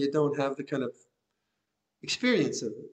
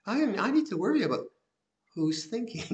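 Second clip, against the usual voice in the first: higher, quicker voice of a protesting mind; objecting inner mind; 6 semitones higher than usual. Retold or imitated. Teacher voicing the mind that resists the practice, higher and quicker. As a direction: play the restless thinking mind talking back. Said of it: "and then the mind".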